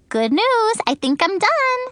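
put-on high, pleased little girl; chirpy announcement of success; excited. A narrator's reading.

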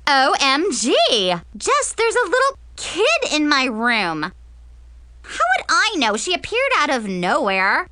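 lightly voiced outraged girl, pitch jumping; exasperated girl on the phone. Girl tells her friend Jess about a kid in her room, exasperated. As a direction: gripe to your friend in a drama-queen tone.

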